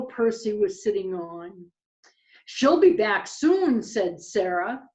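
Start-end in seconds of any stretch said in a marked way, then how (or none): 2.4-3.8 higher, louder little owl voice; lightly voiced little owl, raised and projected; owlet Sarah's line. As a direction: voice a confident owlet out loud. Voicing Sarah owlet in story.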